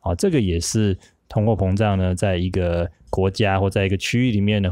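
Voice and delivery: usual speaking voice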